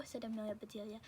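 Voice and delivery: ordinary delivery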